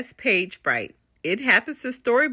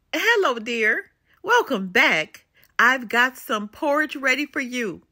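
ordinary delivery, nothing marked

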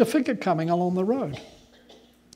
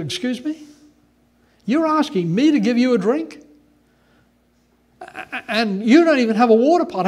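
higher, louder, scornful refusal; the Samaritan woman scoffing at Jesus; 4 semitones higher, 6 dB louder than usual. Acted. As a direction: sneer at the stranger asking for water. Samaritan woman voiced in retelling.

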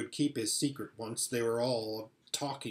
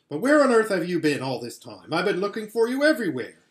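snappish; 5 semitones higher, 8 dB louder, faster than usual. A narrator's reading. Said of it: "how snappishly Edmund spoke".

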